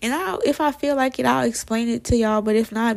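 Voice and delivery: ordinary talk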